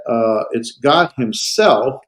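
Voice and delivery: "God himself" drawn out slowly; slow emphatic phrase. Shown as a demonstration. Teacher showing the intensifier to the class. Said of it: "much more of an intensifier".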